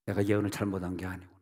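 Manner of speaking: ordinary delivery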